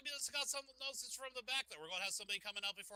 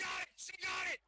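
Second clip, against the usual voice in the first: excited call; 9 semitones higher than usual. Real, not acted. Commentator calling the pin to the audience, excited shout.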